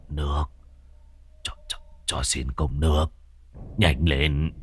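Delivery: lắp bắp. Acted. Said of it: "miệng lắp bắp".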